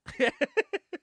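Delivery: a sudden high burst of laughter. Real, not acted. Amused at the Reagan cameo, bursting into laughter. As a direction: laugh out loud at the joke.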